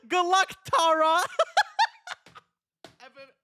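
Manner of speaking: laughing while reading. Real, not acted. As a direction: giggle through the words. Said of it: "laughs through reading".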